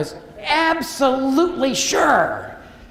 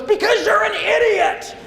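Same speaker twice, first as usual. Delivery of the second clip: much higher, scolding outburst; mock-angry retort; exasperated instructor; 12 semitones higher than usual. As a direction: instructor losing patience in his face. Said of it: "and he goes".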